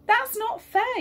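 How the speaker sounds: put-on a boy yelling at his parents that it's unfair; yelled. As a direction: shout it like an outraged child. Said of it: "yelled Callum".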